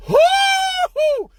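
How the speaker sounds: very high, drawn-out hoot; high comic whoop. Acted. Storyteller mocking his own hopes, high comic whoop. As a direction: hoot way up in falsetto. Whoops before things go wrong.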